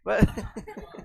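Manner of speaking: helpless wheezy giggle; breaking into laughter. Real, not acted. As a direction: crack up at your own joke. Teacher cracks up at own joke.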